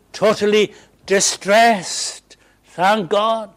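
loud, impassioned pulpit delivery; fervent preaching. Acted. Preacher urging the congregation on, fervent and loud. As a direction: preach it out with conviction.

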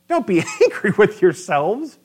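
a real laugh breaks up the verse; laughing through it. Real, not acted. Preacher laughing as he repeats Joseph's line. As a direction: chuckle as you repeat it.

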